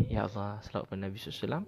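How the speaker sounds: gabbled very fast and soft; rushed selawat. Retold or imitated. Student in an exam reciting selawat, very fast and soft. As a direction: rattle off the selawat in a panicked rush.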